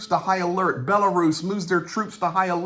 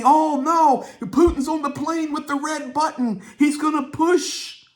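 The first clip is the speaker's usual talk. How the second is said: high-pitched hammed-up panic, much higher; mock panic scream; 12 semitones higher than usual. EU news retold as everybody freaking out, high mock panic. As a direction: squeal it like a frightened mock crowd.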